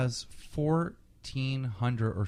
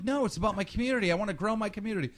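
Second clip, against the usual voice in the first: quick, higher mock protest; mock kid protest; 9 semitones higher, much faster than usual. Host mimicking the kid's hurried denial, high and fast. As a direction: rattle it off like a kid caught out. Kid denies it's about money.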